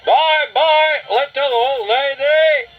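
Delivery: high, loud drawn-out call; long-distance sing-song shout; shouting to someone distant. A narrator's reading. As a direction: holler a teasing farewell. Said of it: "he shouted to the other distant figure".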